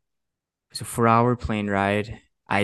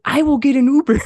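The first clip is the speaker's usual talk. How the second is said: much higher, loud snapping retort; mock angry retort; 7 dB louder than usual. Employee snapping back at his boss, high and loud.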